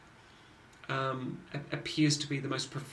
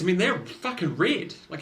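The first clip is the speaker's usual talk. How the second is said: normal talking voice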